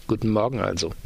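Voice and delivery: ordinary delivery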